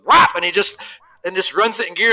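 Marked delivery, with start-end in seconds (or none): 0.0-0.4 loud high mock slap noise; a sharp, high "whop" mimicking the tire hitting; imitated whop. Showing how the tire hit, a loud mock whop.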